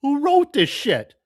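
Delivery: sudden loud, high cry of disbelief; outraged outburst. Real, not acted. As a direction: blurt it out in shock and outrage. Outraged reaction to the assailant.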